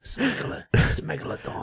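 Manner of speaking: whispered Pommy tough-guy voice; Jason Statham. Shown as a demonstration. Showing how he hits the end, whispered Pommy impression. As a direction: whisper it and land on the end of "megalodon". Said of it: "It's the way you hit Don on the end".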